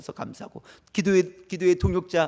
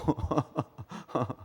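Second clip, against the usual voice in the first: soft, breaking-up laugh; quiet laugh trailing after the words; amused chuckle; 5 semitones lower, 7 dB quieter than usual. Real, not acted. Preacher laughing about swear words between sentences, soft chuckle.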